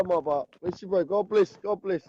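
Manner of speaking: normal talking voice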